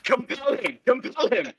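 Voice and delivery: hushed high-pitched mock command; high, softened playful exclamation, each phrase separate. Player urging a reluctant co-player to introduce himself, mock command.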